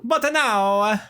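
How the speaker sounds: high mock-Italian stage voice; drawn-out comic Italian accent; super Italian accent. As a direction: announce it with a hammy Italian accent. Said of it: "Just super Italian".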